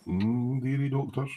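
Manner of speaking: soft, high drowsy mumble of dearie doctors; imitating Colin. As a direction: mumble dearie like a sleepy friend. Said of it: "Colin's like".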